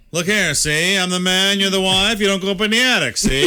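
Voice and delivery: put-on old-movie 1930s gangster talk, pushed up. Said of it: "That's my nineteen thirties voice".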